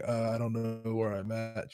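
low, slow, soft stoned mumble; slurred mumbling. Retold or imitated. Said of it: "I was slurring all my words".

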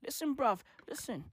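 normal talking voice

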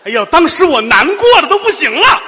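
高八度大声嚷出来的假悲痛; 拔高嗓门假装伤心欲绝; 夸张喊难过. Acted. 夸张表演悲伤. (高声夸张假哭诉)

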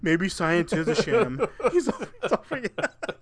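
high-pitched, talking through laughter; laughing. Real, not acted. Friends riffing on the father losing faith, real laughing. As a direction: crack up while getting the joke out. Joking while cracking up.

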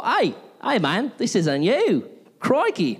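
high, exaggerated Australian accent; hammed-up Aussie border official, swooping up high. Customs officers doubting a traveler's passport photo, hammed-up Australian accent. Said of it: "No, it's Australian".

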